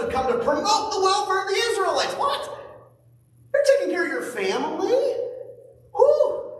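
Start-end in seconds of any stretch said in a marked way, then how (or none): 2.1-6.5 high, scoffing disbelief; mock incredulity. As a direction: scoff like a sneering relative. Mocking critics of boundaries.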